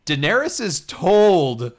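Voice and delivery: TOLD drawn out, high and loud; slow down and hit TOLD hard; emphatic correction. Real, not acted. Host clarifying the Wikipedia summary, emphatic stress on TOLD.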